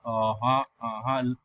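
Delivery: "uh-huh" lifting from low pitch; low "uh-huh" with a rise; rising tone. Shown as a demonstration. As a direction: start "uh-huh" low and slide up. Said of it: "being rising like this".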